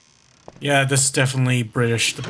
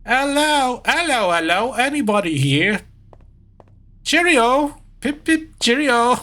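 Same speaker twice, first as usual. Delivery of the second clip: very high, silly British caricature; mock British, pitched way up; bad British accent; 10 semitones higher than usual. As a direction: call out in a hammed-up British voice. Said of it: "What the fuck am I saying?".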